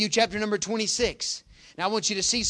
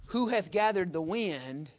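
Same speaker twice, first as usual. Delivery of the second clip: ordinary talk, nothing marked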